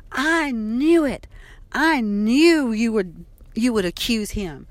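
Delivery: slow, loud mock yell of an angry mother; yelled accusation. Mother yelling at daughter who questions her, slow loud yell. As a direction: hammer each word out, slow and loud. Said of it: "She yelled at me".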